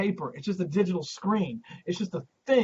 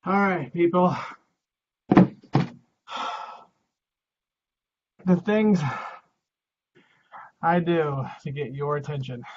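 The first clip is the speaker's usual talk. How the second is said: muffled; 7 dB louder than usual. Real, not acted. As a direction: voice muffled by a box. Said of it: "I'm wearing a box on my head".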